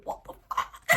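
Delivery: soft breathy whisper of disbelief; bewildered whisper. Retold or imitated. Older self looking back on wasted years, whispered disbelief. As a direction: mouth it like a stunned future self.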